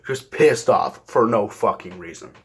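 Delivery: usual speaking voice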